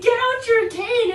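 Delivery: high, loud, slowly stretched command; exasperated order. Retold or imitated. Retelling the order to a blind friend, exasperated. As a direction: insist with mock exasperation.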